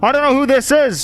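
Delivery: high, insistent protesting voice; person inside house. Acted. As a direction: protest your identity through a door. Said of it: "says the person from inside the house".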